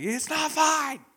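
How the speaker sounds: high, slow, stretched-out wail; 1 panicked whine. Retold or imitated. Boy stuck on a slope answering his teacher, panicked. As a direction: panic as the ground slips. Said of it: "And I'm like".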